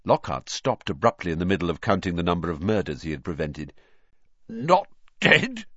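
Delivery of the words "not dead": high, strangled, tearful question; choked disbelief. A narrator's reading. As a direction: choke it out in shocked hope. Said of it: "choked Filch".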